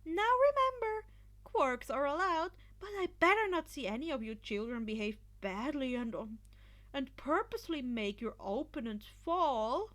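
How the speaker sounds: high, loud teasing referee; Midnight explaining rules. A narrator's reading. Midnight warning the students, high and loud. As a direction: warn the children playfully.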